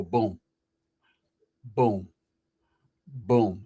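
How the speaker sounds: deep, spaced-out boom on each beat; low-pitched comic thuds. Retold or imitated. Mimics his reaction to her walking.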